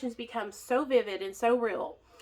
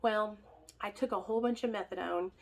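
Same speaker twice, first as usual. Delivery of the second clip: ordinary delivery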